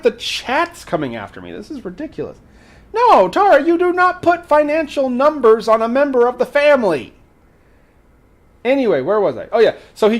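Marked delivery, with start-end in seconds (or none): 2.9-7.1 playful scolding. Pretend. Scolding Tara over pet price.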